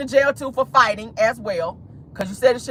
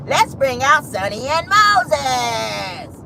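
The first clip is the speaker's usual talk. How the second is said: very slow mock showman's introduction; Carlos deflecting; 2 semitones higher, much slower than usual. Host replaying Carlos's dodge, mocking drawn-out announcement. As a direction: drag out every name, swelling on "out". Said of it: "But what did Carlos do?".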